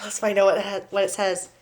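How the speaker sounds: plain reading voice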